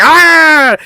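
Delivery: high, loud mock battle cry; acted yell. Girl biting off the cord, high loud imitated yell. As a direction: yell as if lunging in to bite.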